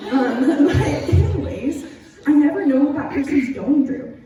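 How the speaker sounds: laughing through the words; laugh, then push on; real laugh. Real, not acted. Laughing off the story.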